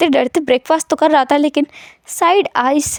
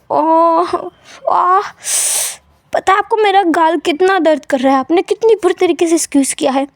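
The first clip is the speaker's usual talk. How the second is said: pained whining; 4 semitones higher than usual. Acted. Son complains of cheek pain.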